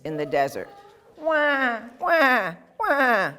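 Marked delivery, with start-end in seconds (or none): 1.2-3.3 higher crying wahs; crying toad. Shown as a demonstration. A teacher imitating a spadefoot toad cry. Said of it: "cries from a puddle".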